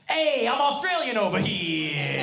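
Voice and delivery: drawn-out, hammed-up botched Australian accent; bad Australian accent. Comic voicing an Australian customer in a bad Australian accent. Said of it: "I am not the best with accents".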